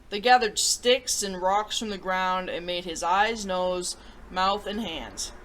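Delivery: put-on reading a picture book to children; storybook read-aloud voice; story narration. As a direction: tell it gently to young listeners.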